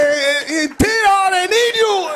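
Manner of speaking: loud, high call into the kitchen; calling the cook loudly from the table; wife calling out. Retold or imitated. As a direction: shout for Tito. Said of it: "She's gonna go and say".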